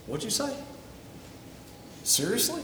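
a pretend "excuse me?" from heaven; incredulous question. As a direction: catch someone grumbling, playfully pointed. Jesus nudging the believer again.

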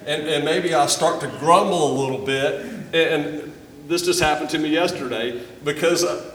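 ordinary talk, nothing marked

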